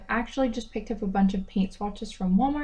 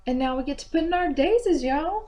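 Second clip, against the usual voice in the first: put-on sudden playful Southern country accent. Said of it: "I just spoke in a country accent".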